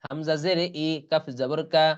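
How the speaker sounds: normal talking voice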